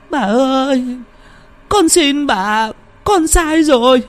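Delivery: very high, loud wailing pleas for mercy; pleading in agony. Acted. Beaten woman begs the ghost for mercy, wailing high. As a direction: beg and cry out as the blows land. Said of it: "đau đớn quằn quại".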